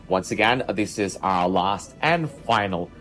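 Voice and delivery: upbeat cruise director. Acted. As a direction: welcome the guests over the ship speakers. Ship PA announcement read.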